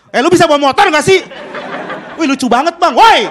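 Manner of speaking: loud mock road-rage yell. Comic acts angry at the motorist after the crash. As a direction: bark at the other driver. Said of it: "Marah-marah sama orang".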